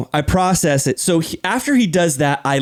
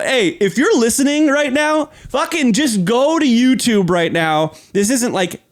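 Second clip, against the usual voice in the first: ordinary delivery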